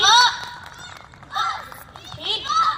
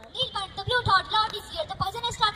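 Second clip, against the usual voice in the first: ordinary talk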